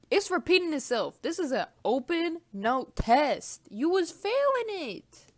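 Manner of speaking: loud, slow, emphatic rant; exasperated rant. Real, not acted. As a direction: scold them loudly. Ranting loudly.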